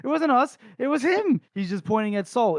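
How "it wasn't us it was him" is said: much higher, louder protest; panicked denial. Retold or imitated. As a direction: shout the blame onto him in a panic. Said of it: "And guy's like".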